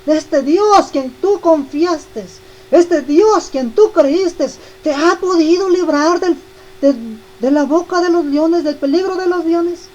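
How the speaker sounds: a frantic king calling down to Daniel; desperate cry. Acted. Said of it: "y grita, y desesperado".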